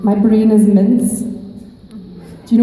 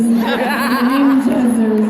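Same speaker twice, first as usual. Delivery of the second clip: laughing; slower than usual. Acted. Mock dramatic Winchesters pun.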